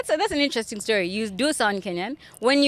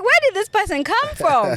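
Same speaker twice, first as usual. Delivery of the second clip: high, amazed question; mock amazement; 10 semitones higher, 3 dB louder than usual. Host recalling the sudden DJ, amazed and high. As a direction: act baffled at where he came from.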